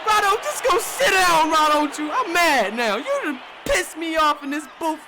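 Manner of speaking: high, loud mock rant at the quarterback; playful fed-up yelling. Real, not acted. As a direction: yell at the screen, half laughing. Heated commentary after bad throws.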